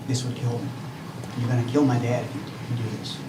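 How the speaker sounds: ordinary delivery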